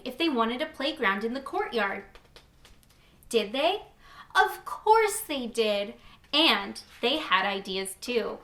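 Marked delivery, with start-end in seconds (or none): none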